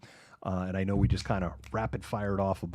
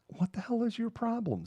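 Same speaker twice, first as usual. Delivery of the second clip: exasperated outburst; 14 semitones higher, 5 dB quieter than usual. Retold or imitated. Dad snapping at yowling cat.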